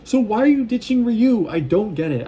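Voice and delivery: high, loud mock confrontation; exasperated questioning. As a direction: demand an explanation in disbelief, pitch jumping up. Vlogger scolding a story character.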